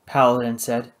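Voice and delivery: usual speaking voice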